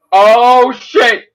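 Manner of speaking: loud, retold cry of shock; shocked onlookers. Retelling onlookers seeing something thrown, acted shock. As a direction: act out the crowd's "uh-oh" at full volume.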